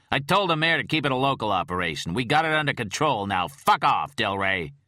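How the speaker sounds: captain telling the agent off; angry outburst. Acted. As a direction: tell him off hard. Captain losing his temper.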